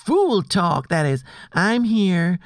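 lightly put-on Southern accent. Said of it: "changing your accent".

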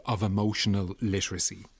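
ordinary talk, nothing marked

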